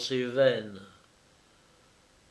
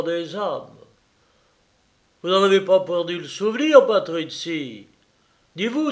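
lightly voiced mocking young man, a little louder; Jacques at the feast; 4 semitones higher, 6 dB louder than usual. Jacques taunting his dinner guests, lightly voiced mockery. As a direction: tease the guests with bitter gaiety.

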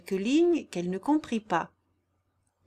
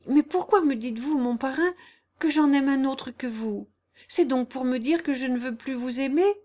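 higher-voiced earnest girl, louder; hurt, puzzled Jeanne; 6 semitones higher, 5 dB louder than usual. Jeanne asking why he doubts her love, hurt. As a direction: question him in innocent distress.

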